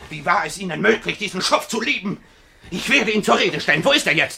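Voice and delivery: high, loud indignant outburst; outraged. Acted. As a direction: rage about the scoundrel, voice rising. Alexei outraged at the rival.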